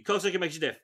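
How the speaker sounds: hurried, indistinct mumble; throwaway rapid mutter; quick mumble. Acted. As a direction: run the words together on purpose. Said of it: "You mumble something really quickly".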